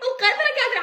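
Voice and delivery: fast, high, laughing through it. Real, not acted. To the girl stuck in her shirt, fast laughing. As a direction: giggle the words out quickly.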